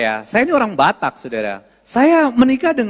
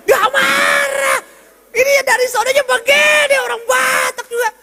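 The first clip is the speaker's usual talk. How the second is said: very high, strained comic yelling; hammed-up exasperated holler; loud Batak man; 13 semitones higher than usual. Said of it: "kalau ngomong pita suara tebal, suaranya keras".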